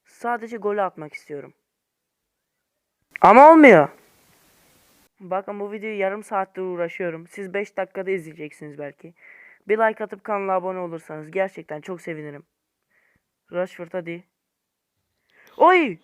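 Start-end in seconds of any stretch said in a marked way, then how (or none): 3.2-3.9 much louder outburst; exasperated shout. Real, not acted. Gamer exploding after another miss, loud. 15.6-16.0 high, loud sudden yelp; startled cry. Real, not acted. Gamer yelping as the shot flies close, startled.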